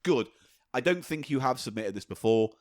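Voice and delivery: usual speaking voice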